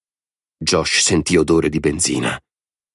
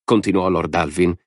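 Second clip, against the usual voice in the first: usual speaking voice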